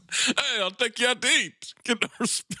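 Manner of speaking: laughing, hammed-up comedian impression; Rodney Dangerfield. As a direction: mimic an old stand-up comic's catchphrase delivery. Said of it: "becoming Rodney Dangerfield".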